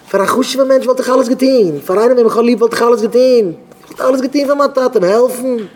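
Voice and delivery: high, insistent declaration; acted fervent protest; impassioned plea. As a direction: plead it with the voice held high. Acted impassioned plea about helping father.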